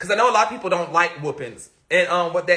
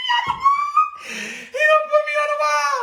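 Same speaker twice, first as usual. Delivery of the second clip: very high, laughing through the words; laughing squeal. Real, not acted. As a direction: squeal it out in disbelief. Laughing disbelief retelling.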